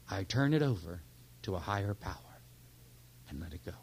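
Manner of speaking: sincere whispered-down prayer; quiet prayer. Shown as a demonstration. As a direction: pray it low and fading. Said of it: "as simple as this".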